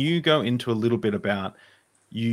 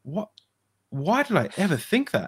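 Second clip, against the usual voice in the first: higher, incredulous; can't believe it, pitched up; amazed disbelief, higher; astonished; 9 semitones higher than usual. Retold or imitated. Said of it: "I'm like".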